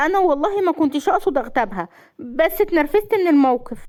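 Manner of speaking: high, anxious self-defence; nervous. A narrator's reading. Wife excusing her backbiting to her husband, high and nervous. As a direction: justify yourself in a high, flustered voice. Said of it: "بلعت ريقها بتوتر وقالت".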